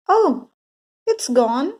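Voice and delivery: high, loud gasp of dismay; teacher discovering the empty tissue; dismayed surprise. A narrator's reading. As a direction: react as if the tooth vanished. Teacher finds tooth missing.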